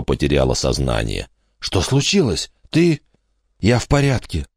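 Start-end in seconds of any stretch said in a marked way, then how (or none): 1.6-3.0 put-on higher, anxious boy voice; a frightened boy checking on her; worried boy. Michael worried over fallen Kate. 3.6-4.5 put-on girl's voice. Kate reassures Michael.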